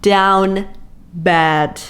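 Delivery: "Down" and "bad" as separate beats; emphatic DOWN BAD. Real, not acted. Reader stressing how smitten the hero is, "Down bad" word by word.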